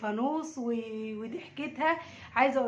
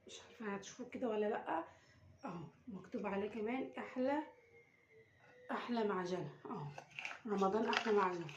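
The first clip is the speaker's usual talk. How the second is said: much softer, hushed so she won't hear; 3 semitones lower, 7 dB quieter than usual. Real, not acted. Mother hiding the lantern from her daughter, hushed. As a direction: keep it down, she's just outside. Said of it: "عشان هي بره وهتحس".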